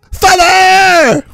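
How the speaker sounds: very high mock scream; hammed-up daughter's cry. Daughter running in to her dad, hammed-up high cry. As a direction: shriek it with full melodrama.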